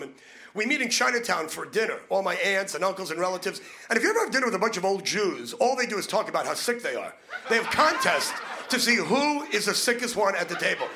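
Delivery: ordinary delivery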